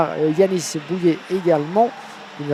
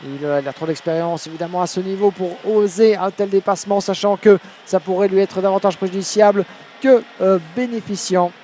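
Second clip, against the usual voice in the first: urgent play-by-play, pressing forward; motorsport commentator; 3 semitones higher, 4 dB louder than usual. Acted. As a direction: call the last lap with urgency. Live French race commentary.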